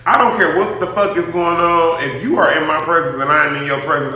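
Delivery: ordinary talk, nothing marked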